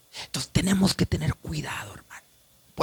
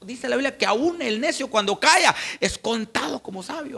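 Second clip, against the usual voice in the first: ordinary talk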